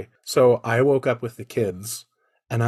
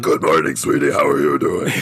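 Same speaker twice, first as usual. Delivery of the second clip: low, growling menacing greeting; demon voice; 10 semitones lower, 5 dB louder than usual. Pretend. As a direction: sound like a horror demon. Replaying demon greeting to daughter.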